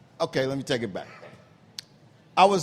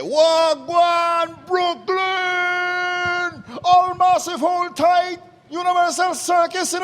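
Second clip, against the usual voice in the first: much higher, loud Jamaican patois hype call; Jamaican patois shout; 12 semitones higher, 5 dB louder, much slower than usual. Retold or imitated. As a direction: rev up the Brooklyn crowd in Jamaican patois. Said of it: "ringmaster who know patois".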